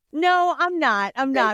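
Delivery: loud, slow flat no; slow emphatic refusal to a friend; firm refusal. Retold or imitated. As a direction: shut the friend down firmly. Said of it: "turn around and be like".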